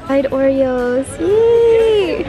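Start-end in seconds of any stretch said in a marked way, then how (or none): 1.2-2.2 high, loud drawn-out yay; excited cheer. Real, not acted. Gets deep fried Oreos.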